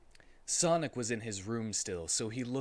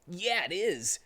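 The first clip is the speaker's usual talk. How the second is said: 1 Sonic agreeing; 6 semitones higher than usual. Acted. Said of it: "said Sonic".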